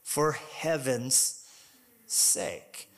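slow down and let it fade; slow, fading emphasis. Acted. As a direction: drop to a near-whispered "sake" at the end. Sermon emphasis slowed phrase.

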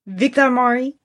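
lightly put-on French accent; amateur French accent, rising at the end. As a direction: say the name with a hint of French. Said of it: "he's very French".

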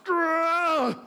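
high hammy growl; playful lusty grrr; mock growl. [name] growls with lust.

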